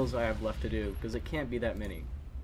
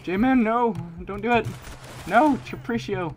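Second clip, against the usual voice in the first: loud high yell of protest; desperate shout; 10 semitones higher, 8 dB louder than usual. Real, not acted. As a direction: scream no at the teammate. Teammate about to die.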